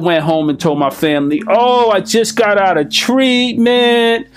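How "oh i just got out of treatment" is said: much higher, slow, overdone sincerity; mock earnest con man selling his story; bullshit artist voice. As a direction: ham up the reformed man to fool the family. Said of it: "as the bullshit artist, could have played myself".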